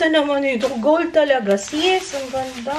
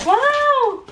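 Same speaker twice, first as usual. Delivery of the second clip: thrilled squeals; 12 semitones higher, slower than usual. Real, not acted. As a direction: squeal with joy over the gift. Child gets camera gift. (tuwang-tuwang tili sa regalo)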